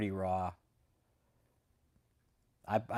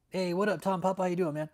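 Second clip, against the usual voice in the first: higher, sing-song imitation of a slick lawyer; mock chummy greeting; 8 semitones higher, 4 dB louder than usual. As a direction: ham up the fake-friendly hello.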